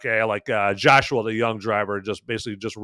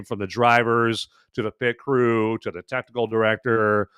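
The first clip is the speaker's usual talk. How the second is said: ordinary delivery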